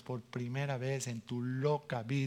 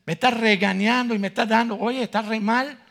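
loud retelling; 8 semitones higher, 11 dB louder than usual. Retold or imitated. Being scolded.

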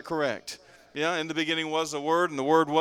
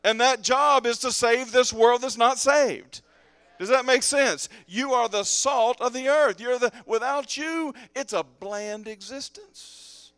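loud, higher-pitched, driving sermon; full-force preaching; 7 semitones higher, 8 dB louder than usual. Real, not acted. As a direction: drive it home to the whole congregation.

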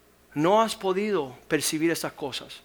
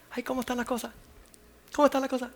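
mocking nosy gossip; 8 semitones higher, much faster than usual. Preacher mimicking people who ask how things are, mock gossipy high voice. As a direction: ham up a nosy busybody asking. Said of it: "Las personas que dicen".

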